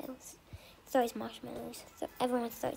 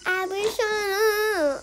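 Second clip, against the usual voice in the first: high, slow, wailing cry; a hammed-up sob stretched out; mock wail; 7 semitones higher, 9 dB louder, much slower than usual. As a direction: pretend crying wail. Crying out in pretend play.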